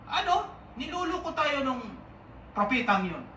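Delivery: high, outraged shout; furious general. Acted. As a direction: spit it out as a fooled, furious general. Naaman fuming at prophet.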